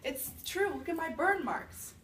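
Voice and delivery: high, loud, slow whine; old woman showing the officer her burns, pleading. Retold or imitated. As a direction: beg the officer to believe you.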